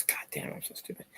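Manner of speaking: real annoyed whisper, scolding himself; whispered self-scolding. Real, not acted. Vlogger scolding himself for blanking on a name, whispered. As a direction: hiss it to yourself, no voice at all.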